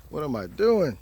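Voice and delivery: normal talking voice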